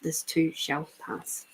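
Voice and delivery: same saying again, almost murmured. Shown as a demonstration. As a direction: let it fade into a hush. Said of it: "there's that good old saying".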